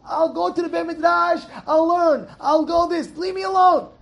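high, loud defiant shouting; shouting back. Retold or imitated. Friend shouting at his Yetzer Hara, defiant and loud. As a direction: shout the tempter down. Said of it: "I hear him speaking".

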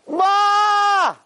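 high, drawn-out victory holler; acted triumphant shout; imitated scream. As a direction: yell to mother like a winning athlete. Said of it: "That's what he's screaming".

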